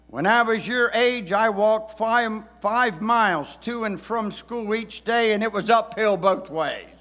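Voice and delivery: put-on loud, high old-days lecture; preachy dad voice. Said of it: "words that many dads have said".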